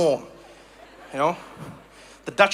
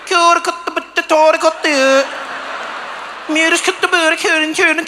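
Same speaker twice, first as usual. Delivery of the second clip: curt, higher-pitched mock Swedish gibberish; comic Swedish impression, raised pitch; 6 semitones higher than usual. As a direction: bite off short syllables like a caricature Swede. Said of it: "like, curt language".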